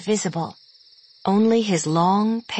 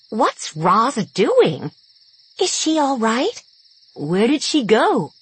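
surprised; 4 semitones higher, 3 dB louder than usual. Acted. Stunned cows asking worried.

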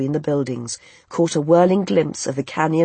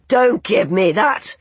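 loud, drawn-out angry retort; shouting back; 5 semitones higher, 5 dB louder, slower than usual. A narrator's reading. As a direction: shout back in disbelief, raised and slow. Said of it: "he shouted back".

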